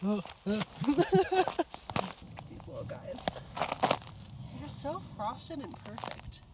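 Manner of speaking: soft, slow, tender cooing. Real, not acted. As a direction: coo softly at tiny frozen plants. Cooing at frosted plants.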